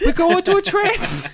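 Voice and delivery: tiny gnome proud of mischief; mischievous gnome voice. Acted. Gnomes bragging to the kids about mischief, very high.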